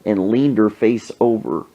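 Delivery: ordinary talk, nothing marked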